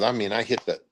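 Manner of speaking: normal talking voice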